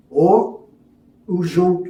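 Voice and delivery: normal talking voice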